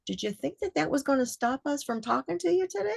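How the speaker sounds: a gentle mock ghostly tease; spirit voice. Spirits speak to her, retold.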